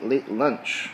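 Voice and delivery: ordinary talk, nothing marked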